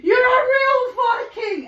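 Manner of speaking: high, loud mock astonishment; acted teasing exclamation. Teasing the frightened Viking neighbour, loud and high.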